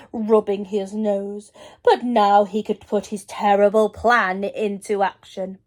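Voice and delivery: usual speaking voice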